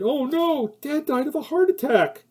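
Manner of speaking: mock high-pitched son objecting. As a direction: squeak it out like a flustered son.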